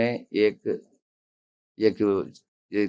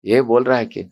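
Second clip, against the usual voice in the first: ordinary talk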